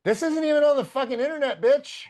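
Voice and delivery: losing it. Retold or imitated. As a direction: replay the moment you lost it, voice shooting up. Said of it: "I start losing my shit".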